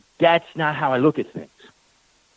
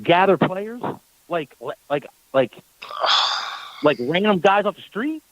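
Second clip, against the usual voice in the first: pitched up, asking in disbelief; incredulous disbelief; 6 semitones higher than usual. Retold or imitated. Retelling to the coach, pitched-up stunned disbelief. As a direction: scoff at the idea of street players. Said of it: "I said".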